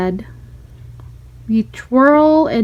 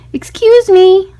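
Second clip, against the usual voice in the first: loud, higher and slow; calling out politely; 8 semitones higher, 6 dB louder, slower than usual. A narrator's reading. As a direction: call out slowly to the teacher. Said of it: "raised my hand".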